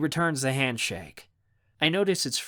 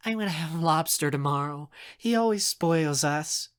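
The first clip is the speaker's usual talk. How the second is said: put-on girlish, eager anticipation, pitched up; high, savoring the thought; 3 semitones higher than usual. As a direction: gloat happily about a treat. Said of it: "Money says eagerly".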